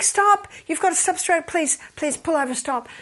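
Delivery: high, pressing demand to pull over; urgent plea. Acted. Wife to yelling husband in the car, urgent plea. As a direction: plead urgently, pitched well up. Acts wife urging driver to stop.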